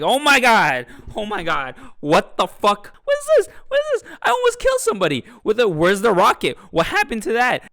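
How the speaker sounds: loud, high-pitched hammed-up panic; freaking out. Imagining waking up as a robot, hammed-up freak-out. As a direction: shriek it in mock hysteria, getting frantic. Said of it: "You would be freaking your shit out".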